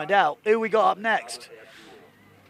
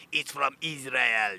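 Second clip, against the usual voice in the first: put-on Israeli accent; 5 semitones lower, 3 dB quieter, slower than usual. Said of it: "that's my Israeli accent".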